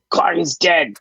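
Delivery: goblin cheer. Acted. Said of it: "The other goblins say".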